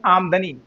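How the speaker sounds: आमदनी broken as आम-दनी, no vowel after म; common mispronunciation. Shown as a demonstration. Teacher quoting how people misread it, आमदनी as आम-दनी. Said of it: "ये शब्द ऐसे बोला नहीं जाएगा".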